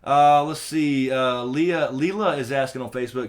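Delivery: ordinary talk, nothing marked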